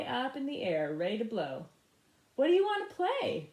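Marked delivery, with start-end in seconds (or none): 2.4-3.5 much higher, louder question; bandleader's lively question. Acted. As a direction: ask him onstage with a grin. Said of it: "Bo Diddley asked".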